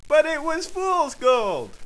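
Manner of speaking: high, slow, loud protest; indignant outcry. Acted. Schnorks protests the cashed gold, indignant and high. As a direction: stretch it out in high-pitched outrage. Heated quarrel over gold.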